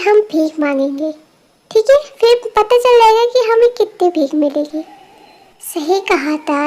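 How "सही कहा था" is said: सही कहा था said slower and softer, drawn out dryly; slow dry repeat. Acted. Repeating the catchphrase to her friend, slow and dry. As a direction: let सही कहा था drag, a touch sarcastic.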